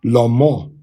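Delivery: "la mo" with a rounded o; o vowel. Shown as a demonstration. Teacher shows the Tiberian o reading. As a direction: give "la mo" a rounded o sound. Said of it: "so this would be".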